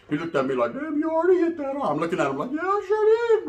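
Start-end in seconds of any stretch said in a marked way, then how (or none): 0.7-1.9 mock rival's look. Rival's look voiced high. 2.5-3.5 much higher smug boast; smug high squeak. Retold or imitated. Comedian answers the rival in a high smug squeak.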